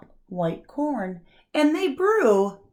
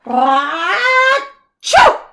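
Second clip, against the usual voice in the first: big bursting achoo, much higher and louder; loud sneeze; 11 semitones higher, 12 dB louder than usual. Acted. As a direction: sneeze it like a huge bear. Bear sneezes.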